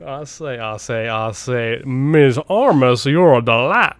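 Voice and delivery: slow, drawn-out Southern drawl; bad Southern accent. Host imagines the actor charming a co-star, bad Southern accent. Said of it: "in a Southern accent".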